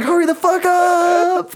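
very high, stretched-out wail; hammed-up desperate plea to be done; mock frantic urging. Urging himself on, retold in panic.

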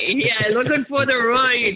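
slow, high, hammed-up Irish accent. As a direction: put on a thick Irish accent, slowly. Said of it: "I'm loving your Irish accent there".

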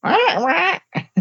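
very high-pitched cartoon squawk; shrill whiner's squawk. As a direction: squeal like a shrill little whiner. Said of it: "he probably has that kind of voice".

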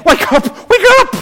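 very high, loud yelp of alarm; hammed-up frantic cry to rouse a sleeper; mock panicked shout. Preacher acting disciples waking Jesus in the storm, mock panicked shout.

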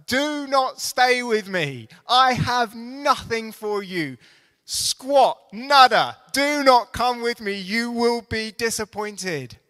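preacher voicing Naomi's plea, forceful and loud; strongest possible terms. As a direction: hammer each word home. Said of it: "state in the strongest possible terms".

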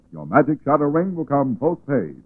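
ordinary delivery

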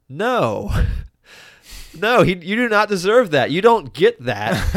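loud, high, fed-up rant with laughs breaking in; indignant outburst. Retold or imitated. Viewer yelling at Utah not to let the robber go, loud indignant rant. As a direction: protest loudly at the screen, half laughing. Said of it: "this is the part where you say, like".

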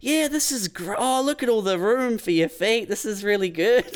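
high, overdone cheery praise; fake enthusiasm. A big brother hyping his sister's ugly scooter, fake cheerful. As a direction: sell it hard to cheer her up. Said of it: "you're always trying to sell it".

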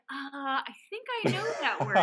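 unsure, feeling out the word; thinking aloud doubtfully; hesitant. Retold or imitated. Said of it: "I was just like".

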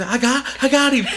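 high-pitched growing glee; imitated triumphant kid. Son realising he scared dad, high giddy triumph. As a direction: let the win sink in, high and giddy.

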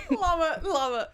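high, loud laughing delight; cracking up, then love it. Real, not acted. Laughing at the impression.

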